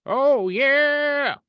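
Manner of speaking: high, loud wrestling-promo yell; excited shout. Real, not acted. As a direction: belt it like a catchphrase. Reacting to a favorite theme.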